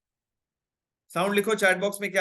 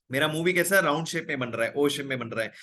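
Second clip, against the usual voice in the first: ordinary talk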